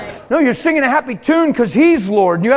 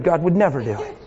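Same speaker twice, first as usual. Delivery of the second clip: ordinary delivery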